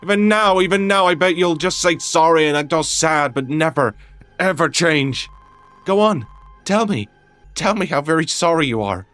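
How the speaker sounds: high, loud, heated outburst; furious accusation. Acted. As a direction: lash out, building to a peak on the taunt. Quarrel peaks, accusing friend.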